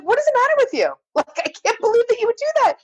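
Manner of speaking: high-pitched mock outrage; retold indignant scolding. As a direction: scold the pranksters in a very high, incredulous voice. Acts out scolding the pranking kids.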